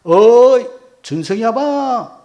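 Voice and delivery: loud, long-drawn hail; leisurely call. Retold or imitated. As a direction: call out unhurriedly from afar, stretching the name. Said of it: "아주 느긋하게". (느긋하게 길게 뽑아 크게 부르기)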